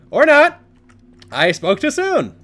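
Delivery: high, loud startled cry; startled shout. Real, not acted. Startled by the monster, loud high yelp.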